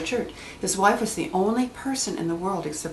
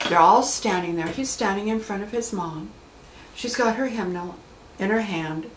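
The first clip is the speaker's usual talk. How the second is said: normal talking voice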